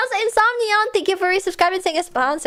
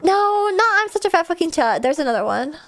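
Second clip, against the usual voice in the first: ordinary talk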